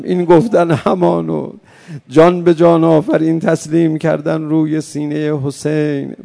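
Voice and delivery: loud, grieving sermon close; mourning preaching. Acted. Preacher ending the martyrdom tale for mourners, loud lament. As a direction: land the lament with force.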